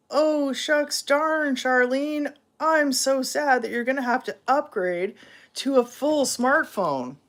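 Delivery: high, sarcastic sing-song pity; mock sympathy. Teasing her friend about the new phone, mock sympathy. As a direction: fake sorrow laid on thick. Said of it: "I'm sure you can hear the sarcasm in my voice".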